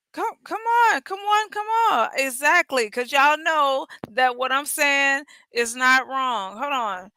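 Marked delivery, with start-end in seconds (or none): 0.1-2.1 high-pitched, repeated coaxing. Real, not acted. Host urging listeners to agree, high coaxing. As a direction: coax them in a raised pitch.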